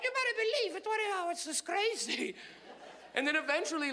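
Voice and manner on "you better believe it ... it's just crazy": high-pitched, spaced-out mock mom; high, happy mother. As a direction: marvel as a stoned immigrant mother. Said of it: "She's like".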